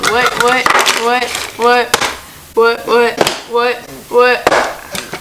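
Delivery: loud, chanting what what at the missing brush; frantic what what. Real, not acted. Hunting for the lost brush, loud chanting what. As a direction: chant it like a search alarm. Can't find her brush.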